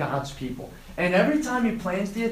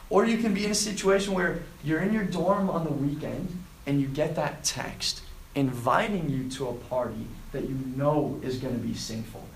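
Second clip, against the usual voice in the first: ordinary talk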